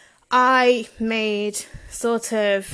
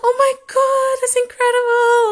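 very high-pitched, delighted astonishment; imitated amazement; 13 semitones higher, 4 dB louder than usual. Wrestler hearing about birthday message, high imitated amazement. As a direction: imitate a thrilled reaction, pitched way up.

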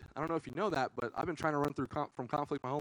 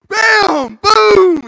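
very loud, high bam boom; shooting yell; 21 dB louder than usual. Retold or imitated. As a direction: blast the shot noises out. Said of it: "I'm like".

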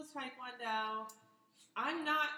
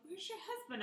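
curious onlookers; 11 semitones higher, 7 dB quieter than usual. Retold or imitated. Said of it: "we'll have people like".

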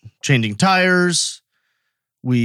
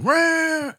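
high mock engine roar, sudden and sharp. Describing the truck redlining, imitated engine roar. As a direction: make the truck's engine scream.

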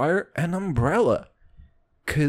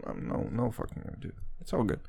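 ordinary delivery

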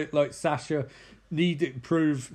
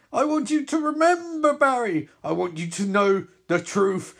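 higher, louder villain monologue; menacing villain; 5 semitones higher, 4 dB louder than usual. Acted. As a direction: loom over the choking hero. Villain voice held.

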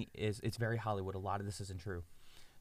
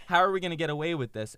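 loud, fast, raised-pitch fretting; mock freak-out; 7 semitones higher, 7 dB louder, faster than usual. Two schemers fearing they'll be caught, mock freak-out. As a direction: rush it out in comic alarm. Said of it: "freaking out".

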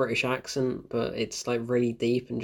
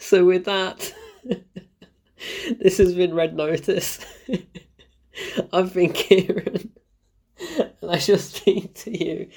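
laughing sign-off; 5 semitones higher than usual. Real, not acted. Signing off still amused.

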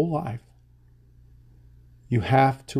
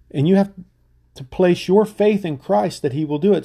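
preaching at full force; 5 semitones higher than usual. Real, not acted. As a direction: swell the voice and drive the faith line out. Preacher urging the congregation.

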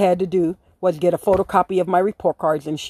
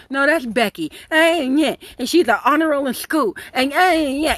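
mocking gossip; 7 semitones higher than usual. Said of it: "I heard them all talking shit".

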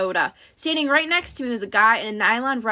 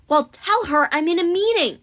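higher, impatient brush-off; mildly exasperated; 7 semitones higher than usual. A narrator's reading. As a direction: dismiss the interruption with some exasperation. Jogger brushes off Martha, exasperated.

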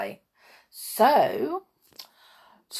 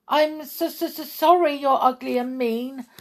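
higher halting sorry; stammering; 7 semitones higher, 5 dB louder than usual. Acted. Thumper stammers to the bear, higher halting apology. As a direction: trip over the apology. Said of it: "Thumper stammered".

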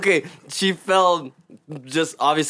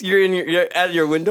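ordinary talk, nothing marked

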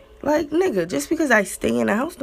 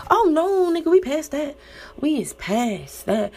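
indignant retort; 8 semitones higher than usual. Retold or imitated. Answering boyfriend, scoffing and raised. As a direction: shut him down with disbelief.

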